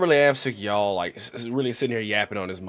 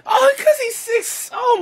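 much higher, slow anguished wail; dismayed wail; 8 dB louder, slower than usual. Real, not acted. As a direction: cry out at the bad play. Bad play in the game.

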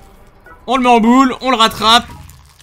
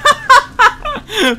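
a high, helpless burst of laughter; sheepish high cackle; high giggle. Real, not acted. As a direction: laugh at your own blunder. Laughs at own blunder.

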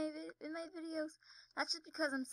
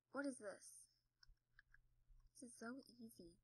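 usual speaking voice

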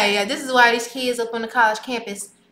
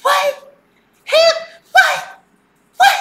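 mock outraged disbelief; shocked disbelief; much slower than usual. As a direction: squeal it in disbelief. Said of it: "He like".

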